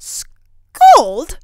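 high, very loud outraged cry; angry shriek. A narrator's reading. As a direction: screech the word in outrage. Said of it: "cried Turritella angrily".

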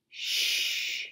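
soft, drawn-out shh; hushing shush. Acted. Monkey hushing the others so Mama sleeps, hushing. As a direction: hush them with a long shh.

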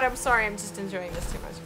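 ordinary talk, nothing marked

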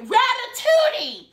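very high, slow stunned disbelief; incredulous. Retold or imitated. Hearing about the rat, high slow disbelief. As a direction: draw it out in shock. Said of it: "I was like".

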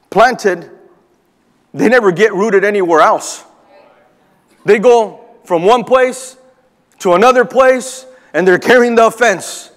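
preaching at full force. Acted. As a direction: press the point home to the congregation. Preacher builds into sermon.

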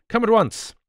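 loud, high-pitched summons; urgent cry. A narrator's reading. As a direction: cry out for immediate help. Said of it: "I cried".

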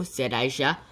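ordinary delivery, nothing marked

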